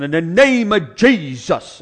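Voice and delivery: loud, slow, drawn-out "In the name of Jesus"; preacher's prayer. Retold or imitated. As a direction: ham up a revival prayer. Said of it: "that way of praying".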